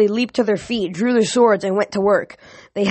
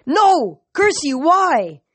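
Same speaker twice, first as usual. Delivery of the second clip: grief-stricken cry; 8 semitones higher, 3 dB louder, much slower than usual. A narrator's reading. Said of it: "shaken with grief, cried".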